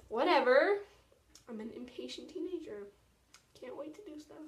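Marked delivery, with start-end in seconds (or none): 0.1-0.8 loud dismissive whatever. Real, not acted. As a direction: shrug it off loudly.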